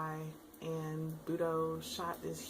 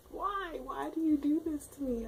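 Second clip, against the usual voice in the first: anguished why; 9 semitones higher, 5 dB louder than usual. Retold or imitated. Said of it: "I was like".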